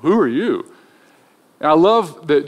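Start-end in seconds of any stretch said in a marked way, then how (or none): 0.0-0.7 taken-aback mock "whoa", then a pointed question; sarcastic. Disciples size up a nosy stranger, mildly sarcastic. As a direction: imitate a lightly sarcastic brush-off. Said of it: "it's a pretty sarcastic response".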